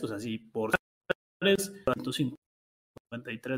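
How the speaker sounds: broken-up call audio, words dropping out; bad internet line breaking up; cutting out. Real, not acted. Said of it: "se está cortando un poquito".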